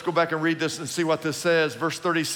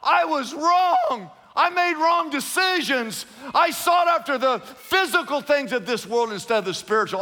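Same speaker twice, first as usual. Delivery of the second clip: anguished shout; 9 semitones higher, 4 dB louder than usual. Retold or imitated. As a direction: cry out your regret to God. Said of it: "You'll be saying".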